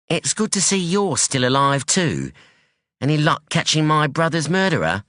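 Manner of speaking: a sharp, wary daughter; hint of a guarded young woman; young woman Anyi. Anyi voiced in story.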